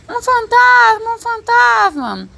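high, drawn-out panicked scream; crowd-in-panic cry, loud and stretched; gritando em pânico. A narrator's reading. (grito agudo e arrastado de pânico)

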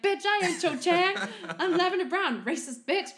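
high, loud sassy snap; mock angry retort. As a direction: bite back with hammed-up outrage. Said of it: "She's like".

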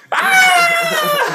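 shrieking laugh; high laughing shriek. Real, not acted. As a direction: squeal with laughter. Yell after joke.

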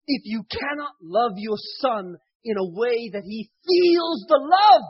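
fervent, loud sermon delivery; preaching. Acted. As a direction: preach it out with full force. Preaching the moral.

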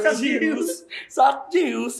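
high hyped shout; excited. Real, not acted. (teriak bersemangat)

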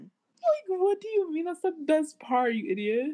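high-pitched disbelief, easing down to the insult; incredulous mock scolding. Scolding a singer who skipped the best part, incredulous.

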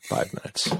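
soft echoed whisper. Real, not acted. Echoing the cohost's joke about episode length, whispered. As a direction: breathe the words back.

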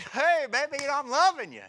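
high, loud crooning; smooth-talking husband. Retold or imitated. Preacher acting himself sweet-talking his wife, high and loud. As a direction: woo her, hammed-up.

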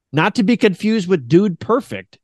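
usual speaking voice

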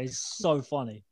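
normal talking voice

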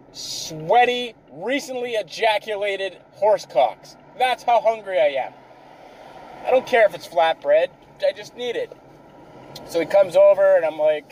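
normal talking voice

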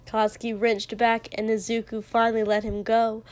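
plain reading voice

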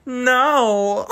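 high, loud, stretched-out cry; hammed-up rejection breaking into laughter; mock horrified refusal. [name] answers Jake's love confession, mock horrified refusal.

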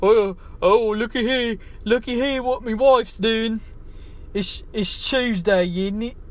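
high, slow, goofy mock voice; silly high character voice. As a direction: ham up a dopey show-off narrating. Mocking a silly video host.

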